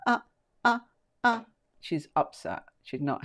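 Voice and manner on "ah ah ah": high, pained little yelps like a nervous dog; small frightened dog yelps; imitated anxious yelp. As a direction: mimic a scared dog whimpering in short cries. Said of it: "she's barking in anxiety".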